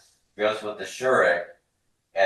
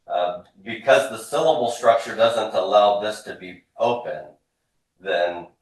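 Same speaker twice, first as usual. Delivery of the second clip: ordinary talk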